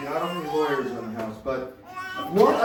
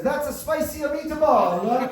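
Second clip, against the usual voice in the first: drawn-out, high, loud mock voice; Jeff Wolverton impression; 8 semitones higher, 5 dB louder, slower than usual. Comic retelling friend's remark at the cabin, hammed-up impression. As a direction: do a hammed-up impression, stretched out and pitched up. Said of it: "do my best Jeff Wolverton impression on this".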